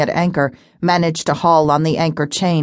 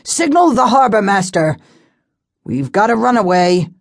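urgent call; 4 semitones higher, 3 dB louder than usual. Acted. Pilot calling orders to assistant.

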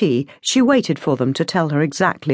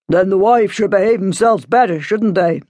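father's curt comeback; lightly angry father; stern retort; 4 semitones higher, 4 dB louder than usual. A narrator's reading. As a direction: dismiss the objection firmly.

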